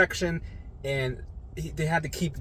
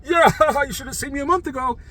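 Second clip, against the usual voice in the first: high, exuberant imitation with laughter; laughing patient; 11 semitones higher, 5 dB louder than usual. Roommate marvelling at his recovery, laughing. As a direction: laugh through a high, quick retold boast.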